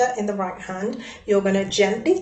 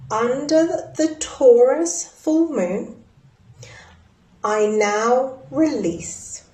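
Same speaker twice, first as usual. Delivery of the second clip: slow ceremonial dictation, Taurus full moon lifted; ritual words; 3 semitones higher, much slower than usual. Shown as a demonstration. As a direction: intone it slowly like a ritual line. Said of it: "write down the words".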